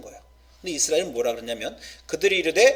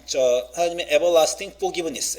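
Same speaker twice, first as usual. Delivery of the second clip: normal talking voice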